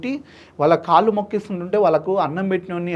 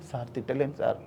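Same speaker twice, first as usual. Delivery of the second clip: softer, lower, pleading through tears; tearful plea; 5 semitones lower, 9 dB quieter than usual. Retold or imitated. Man pleading with the judge, softly tearful. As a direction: beg the judge quietly, near crying. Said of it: "అని ఏడుస్తున్నా".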